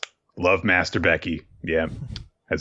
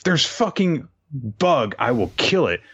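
startled by bug; 7 semitones higher than usual. Real, not acted. Spots a bug mid-story.

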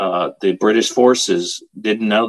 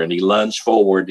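usual speaking voice

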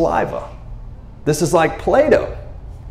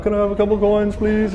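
higher, meek beggar pleading please; a timid, pitched-up beggar asking softly; 6 semitones higher, 4 dB quieter than usual. Retold or imitated. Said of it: "I don't know if he talked like that".

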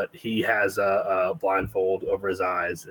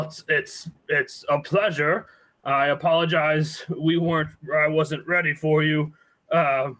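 higher, fumbling and apologetic; flustered old man; 6 semitones higher than usual. Acted. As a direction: stumble over the words, flustered. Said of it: "he's kinda gonna get flustered".

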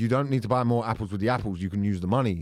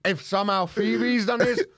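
high, slow laughing disbelief; laughing exasperation; 8 semitones higher than usual. Real, not acted. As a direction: fake outrage through laughter.

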